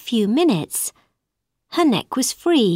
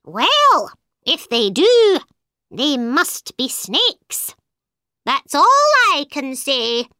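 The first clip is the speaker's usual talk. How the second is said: higher, slow bird voice sure of itself; accusing bird; 6 semitones higher, slower than usual. A narrator's reading. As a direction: lay out the case slowly like a smug bird. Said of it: "said the bird".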